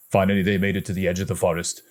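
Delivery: ordinary delivery, nothing marked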